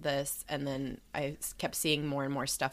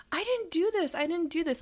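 put-on upset child; 14 semitones higher than usual. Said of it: "like, so upset".

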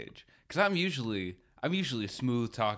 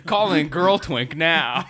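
mock assistant; 4 semitones higher, 8 dB louder, slower than usual. Assistant renames wife.

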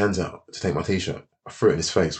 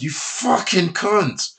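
drawn-out, very slow insult; cursing the teammate, slow and seething; slow seething curse; 9 semitones higher, much slower than usual. Retold or imitated. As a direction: spit each word slowly. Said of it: "I said".

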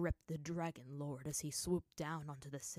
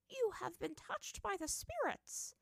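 put-on high, slow, wondering old voice; old woman in awe; 13 semitones higher than usual. A narrator's reading. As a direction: marvel at what you see. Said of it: "Kana said in awe".

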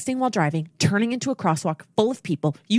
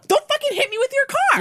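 furious yell; 4 dB louder than usual. Retold or imitated. Retelling yelling at the driver.

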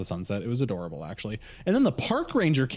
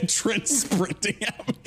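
ordinary talk, nothing marked

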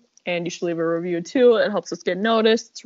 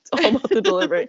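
fast hammed-up; 8 semitones higher, much faster than usual.

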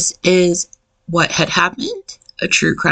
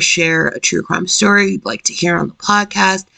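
ordinary talk

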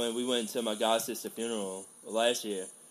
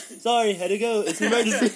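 louder, higher hurried apology; mock frantic higher louder sorry; frantic excuse; 11 semitones higher, 10 dB louder than usual. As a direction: blurt the excuse in a rush. Frantic retold excuse, laughing.

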